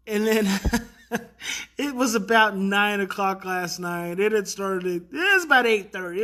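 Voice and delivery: ordinary talk, nothing marked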